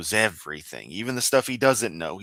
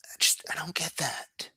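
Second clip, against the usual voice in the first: unvoiced, softer puzzled whisper; 8 semitones higher, 6 dB quieter than usual. Real, not acted. Streamer puzzling over the preacher's reaction, whispered. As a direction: breathe it out, baffled. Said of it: "whispered aside".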